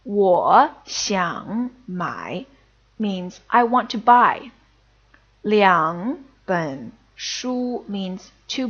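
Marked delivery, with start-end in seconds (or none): 0.0-2.5 我想买 spaced out slowly; each syllable stretched; slow demo. Shown as a demonstration. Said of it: "split right down the middle". 5.4-7.8 我想买 spaced out slowly; each syllable stretched; slow demo. Shown as a demonstration. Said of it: "split right down the middle".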